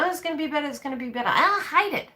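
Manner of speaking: high, whining mimic that breaks into griping; mock whiny complaint. Imitating a complaining partner in a high whine. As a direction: parody the moaning, going up high. Then the complaining starts coming up again.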